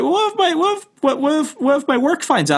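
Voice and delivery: imitating the friend fretting about work, stammering high; mocking stammering friend. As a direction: stumble over the words up high. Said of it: "He's like".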